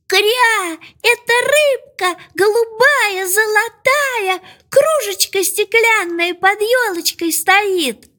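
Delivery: very high duckling voice; excited duckling. A narrator's reading. Duckling shows mother its catch, calling back high and excited.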